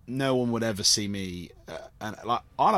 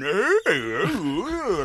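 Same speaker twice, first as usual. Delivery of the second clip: high hooting ooh sounds, louder; mock scary wails; 8 semitones higher, 4 dB louder, much slower than usual. Auditioner trying to scare the panel, wild hooting. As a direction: hoot wildly like a flailing madman. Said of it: "some other guy was running around going".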